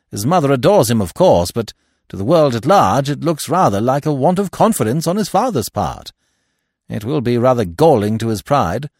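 loud, high, emphatic Stoner; Stoner, vehement. Acted. Stoner judging the will rough on the son, vehement. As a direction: argue the point with force.

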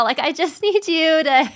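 usual speaking voice

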